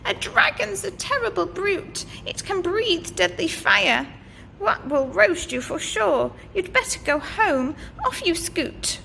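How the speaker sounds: a hint of a fretful old witch; nervous witch.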